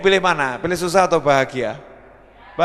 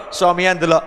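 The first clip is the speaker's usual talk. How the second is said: ordinary talk, nothing marked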